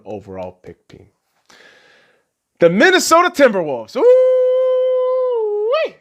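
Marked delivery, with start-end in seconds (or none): 4.0-5.9 high, gleeful whoop; a sudden squeal of mock delight; excited whoop. Real, not acted. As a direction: let out a delighted cry. Excited whoop at Wolves getting the pick.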